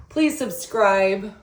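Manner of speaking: drawn-out plea. Real, not acted. Outro plea to viewers.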